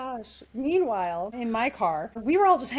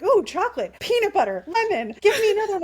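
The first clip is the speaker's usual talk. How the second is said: greedy conductor; 7 semitones higher, 5 dB louder than usual. Retold or imitated. Greedy conductor demanding snacks.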